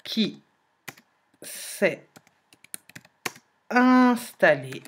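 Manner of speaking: slow dictation with long pauses between words; dictating word by word. Shown as a demonstration. Teacher writing the sentence on the board, slow dictation. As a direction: spell it out as you write it on the board. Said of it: "en écrivant la phrase".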